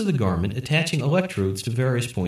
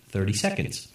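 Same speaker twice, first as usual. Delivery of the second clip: usual speaking voice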